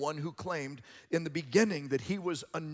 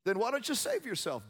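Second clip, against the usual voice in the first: raised, high taunt of imitated soldiers; mocking; 8 semitones higher, 3 dB louder than usual. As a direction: lightly jeer like a soldier at a prisoner. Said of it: "Soldiers mocked him".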